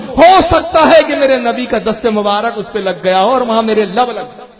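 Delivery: loud, forceful declaration of love; impassioned rebuke. Acted. Imam Malik answering those who question his kissing stones, impassioned. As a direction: preach the reply at full force.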